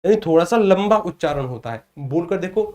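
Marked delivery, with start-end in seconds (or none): none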